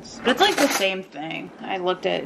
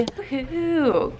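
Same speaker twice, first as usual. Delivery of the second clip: ordinary delivery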